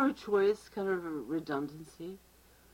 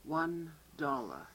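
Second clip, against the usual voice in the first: slow deadpan clerk; 6 dB quieter, much slower than usual. Acted. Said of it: "I'm the clerk".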